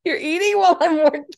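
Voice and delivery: high, fast, laughing protest; giggling mock outrage at a snacking friend; laughing complaint. Real, not acted. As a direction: complain through real laughter. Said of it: "laughing complaint at friend".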